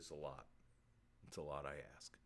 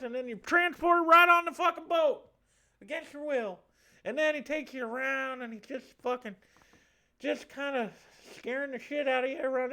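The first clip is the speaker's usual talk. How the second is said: high, animated Southern storyteller with a lisp; effeminate lisping Southerner; 7 semitones higher, 5 dB louder than usual. Acted. Spirit tells sitters about the afterlife, effeminate Southern lisp. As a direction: chatter away as a camp Southern ghost. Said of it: "the effeminate southern man with a lisp".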